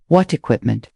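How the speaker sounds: ordinary delivery, nothing marked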